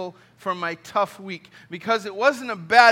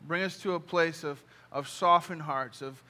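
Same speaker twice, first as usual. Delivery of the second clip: closing prayer; 3 semitones lower, 3 dB quieter than usual. Acted. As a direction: pray gently over the room. Closing prayer over congregation.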